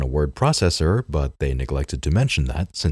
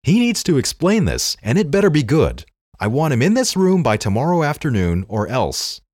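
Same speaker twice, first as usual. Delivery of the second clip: much higher, louder angry outburst; furious shout; 8 semitones higher, 4 dB louder than usual. Acted. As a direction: yell it like a boss who was betrayed. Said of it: "he fumed".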